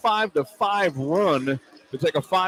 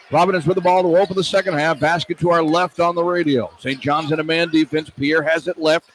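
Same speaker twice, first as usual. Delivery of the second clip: brisk, louder radio game call; live play-by-play; 5 dB louder than usual. Real, not acted. Radio announcer calling the game for listeners, lively play-by-play. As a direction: call each move as it happens.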